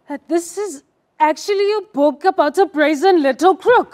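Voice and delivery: high, loud, slow accusing read; the actress reads the poem as a furious mother, high and loud; angry mother reading. Shown as a demonstration. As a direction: read it as the mother losing her temper. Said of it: "let me try it".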